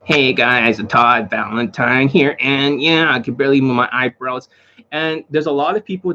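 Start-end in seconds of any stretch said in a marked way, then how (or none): 0.0-4.5 droning monotone impression, drawn out; Todd Valentine. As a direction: mimic a stiff monotone greeting.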